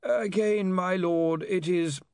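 high-pitched, sighing counsel; weary legal adviser, cut off; long-suffering lawyer Slant. Acted. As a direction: start high, patiently correcting a lord. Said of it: "who gave a little sigh".

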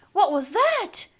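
high, loud astonished question; startled gasp. A narrator's reading. Snip startled by a sudden whoosh, gasping. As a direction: gasp it out in alarm. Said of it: "gasped Snip".

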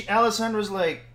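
usual speaking voice

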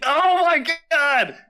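loud, high, drawn-out cry of amazement; excited outburst. Real, not acted. Quiz host to guesser who got it, excited outburst. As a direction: burst out high and stretch it.

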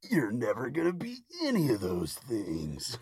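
a lightly voiced inner critic, hardly changed from her own; the doubting voice in her head, only faintly put on; a hint of a dark whisperer; dark shadow voice. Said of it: "I hear the dark shadow".

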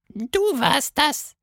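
very high, slow cheeky creature; slow, high and playful; tiny teasing voice. Acted. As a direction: tease the girl sweetly. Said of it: "antwortete die Stimme".

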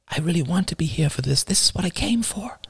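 put-on very high, soft little girl voice; a sorry young daughter, small and high; young daughter apologizing softly to her father. Daughter's voice again.

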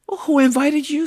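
high, loud indignant challenge. A narrator's reading. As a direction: demand it of an unwanted visitor. Grumpy Hank challenges the lizard.

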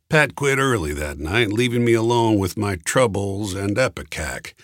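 plain reading voice

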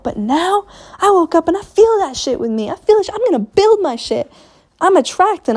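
rising to a triumphant peak; fired-up excitement. Real, not acted. To her listeners, hyped about her own change, excited.

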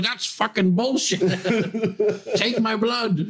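high, slow mock grumpy patient. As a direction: grumble it as a cranky old man. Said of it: "He's like".

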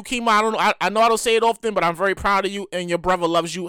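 ordinary talk

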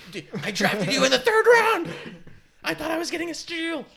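very high, full-volume comic shriek; mock outraged screaming. Fantasy owner berating his third-round pick, outraged screaming. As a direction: scream it in hammed-up betrayal. Mock rant at a drafted player.

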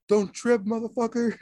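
high-pitched, teasing comeback; retold comeback in a put-on high voice; mock retort. Retort to outraged friend, retold.

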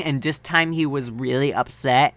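ordinary talk, nothing marked

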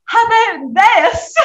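high, loud, drawn-out attempt at British; bad British accent. Child actor retrying for the casting people, botched British accent. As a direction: badly fake a British accent, loudly. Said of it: "that's not a British accent".

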